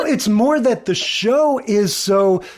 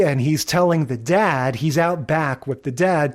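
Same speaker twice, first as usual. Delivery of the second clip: ordinary talk, nothing marked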